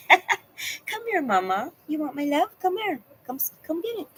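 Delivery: high cooing pet voice, laughing. Real, not acted. Calling her dog over, high cooing baby talk.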